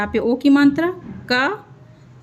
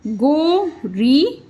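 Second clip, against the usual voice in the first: slow sounding out; much slower than usual. Shown as a demonstration. Teacher sounding out गोरी slowly for pupils. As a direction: stretch गोरी very slowly, rising at the end. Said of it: "ई का sound मिला इसमें".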